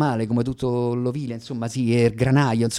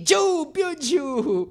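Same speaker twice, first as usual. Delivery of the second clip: slow, high drawn-out cry going down; high, long plunging "giù, più giù"; falling cry; 13 semitones higher, much slower than usual. Acted. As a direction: wail it out as you drop through the floor. Falling again, on stage.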